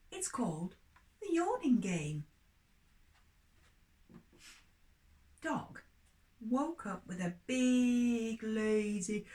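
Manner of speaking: lively storybook reading for little ones; story reading for children. Acted. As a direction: tell it like a bedtime story to children.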